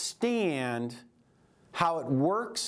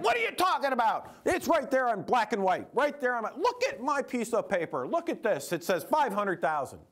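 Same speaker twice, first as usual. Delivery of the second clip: higher, mock exasperated client; indignant protest; 6 semitones higher than usual. Retelling the client insisting on his statement, indignant and higher. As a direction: replay his outraged disbelief, pitched up. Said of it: "He's like".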